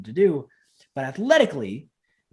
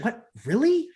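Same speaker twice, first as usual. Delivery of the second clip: high, rising disbelief; incredulous question, pitched up; surprised trainee; 8 semitones higher, slower than usual. Retold or imitated. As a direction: imitate a student caught off guard. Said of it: "And they go".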